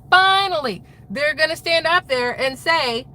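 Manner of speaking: picture your hope finally answered; imagining the big reveal with eager excitement; imagined excitement. Retold or imitated. Hopeful imagined exclamation.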